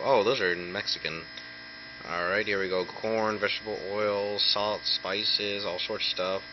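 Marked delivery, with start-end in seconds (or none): none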